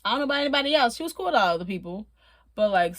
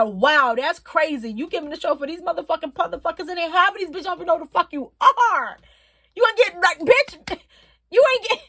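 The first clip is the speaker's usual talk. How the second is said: teasing sass; 5 semitones higher, 4 dB louder than usual. Retold or imitated. Retold teasing to [name].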